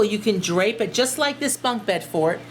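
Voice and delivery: ordinary talk, nothing marked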